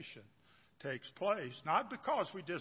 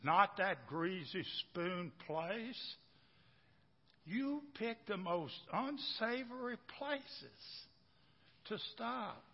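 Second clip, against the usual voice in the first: slow, higher, disdainful wife; a hint of a scornful wife; 5 semitones higher, much slower than usual. Wife scolds husband about greasy spoons, slow and higher. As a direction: draw it out with mild disgust.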